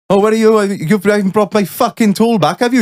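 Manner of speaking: put-on high, accusing Welsh accent. Welshman chasing his mate for his tool, Welsh accent. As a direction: demand it back as an irate Welshman. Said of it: "I'll do my best Welsh accent".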